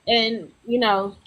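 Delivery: normal talking voice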